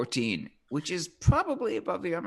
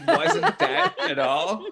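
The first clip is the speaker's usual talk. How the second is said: high, slow laughing protest; laughing denial; 11 semitones higher, 3 dB louder, much slower than usual. Real, not acted.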